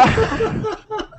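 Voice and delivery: a sudden high laugh bursting out; high burst of laughter. Real, not acted. Cracking up while telling the phone story. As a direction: laugh high and hard at the memory.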